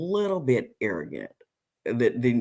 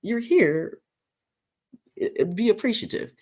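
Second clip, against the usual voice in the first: sassy retort; 11 semitones higher than usual. Retold or imitated. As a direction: tell her off with sass. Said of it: "And I'm like".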